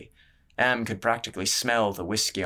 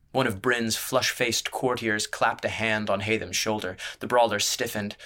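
ordinary delivery

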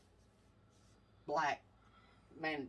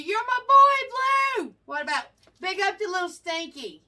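much higher, louder, stretched out; excited call to the pet; 9 semitones higher, 9 dB louder than usual. Real, not acted. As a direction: gush over the pet as you call his name.

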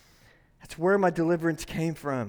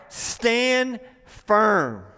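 usual speaking voice

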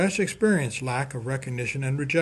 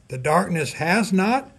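rising, louder declaration with weight on not; emphatic proclamation; 5 semitones higher, 6 dB louder than usual. Real, not acted. Preacher to congregation, emphatic declaration.

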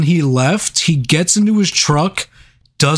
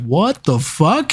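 slow puzzled question; 2 semitones higher, much slower than usual. Retold or imitated. Retold puzzled reaction.

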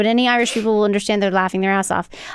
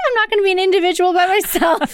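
mock tantrum; 8 semitones higher, faster than usual. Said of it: "throws a fit".

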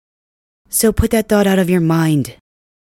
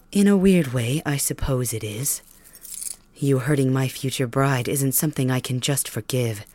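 ordinary delivery

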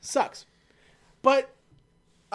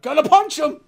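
higher, louder brash shout; cocky loud boast; 7 semitones higher, 10 dB louder than usual. Retold or imitated. H announces he will punch them, loud and brash. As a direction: bark it out like a hothead. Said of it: "and H is like".

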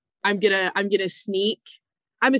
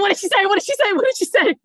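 high, frantic repeated question; frantic demand; 12 semitones higher, 4 dB louder, faster than usual. Real, not acted. Player pleads with the game master, frantic. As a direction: beg for the answer, pitch way up.

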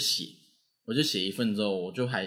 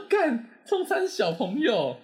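much higher, very slow, laughing; startled laugh; 12 semitones higher, 3 dB louder, much slower than usual. Retold or imitated. Shocked at the gang arriving for him, slow laughing high exclamation. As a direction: draw it out through a shocked laugh. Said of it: "我也吓到".

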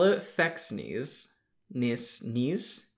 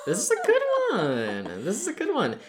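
excited; 10 semitones higher than usual. Real, not acted. Excited about next puzzle.

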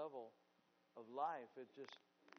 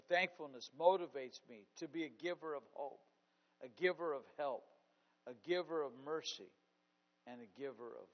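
"thankfulness motivates me" repeated with louder emphasis; 8 dB louder than usual. Shown as a demonstration. Preacher repeating his point to the congregation, louder. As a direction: hammer "giver" home with volume.

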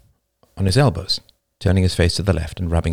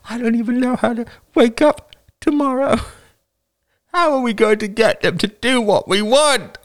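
high, sobbing despair; bursting into tears. A narrator's reading. Older woman despairing of tomorrow, in tears. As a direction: wail through tears with gasping breaks. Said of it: "An older woman burst into tears".

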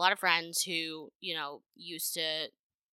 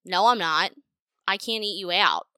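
mock loud insistence; 8 dB louder than usual. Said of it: "He said".

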